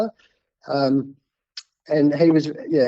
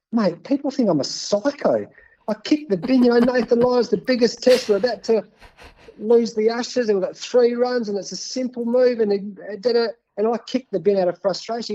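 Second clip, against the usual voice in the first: worked-up plea; 7 semitones higher than usual. Retold or imitated. Pleading with producer over footage.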